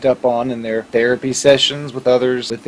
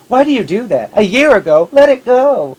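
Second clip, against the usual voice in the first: much higher, louder mock exasperation; exasperated scolding; 9 semitones higher, 4 dB louder than usual. People telling the victim to let it go, high and exasperated. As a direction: scold them to drop it. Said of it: "And people will say".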